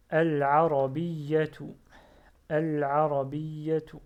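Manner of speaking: al-arabiyyatu read loud and slow; slow model reading. Shown as a demonstration. Teacher modelling the last word slowly and loudly. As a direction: stretch al-arabiyyatu out for the class. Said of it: "teacher models Arabic reading".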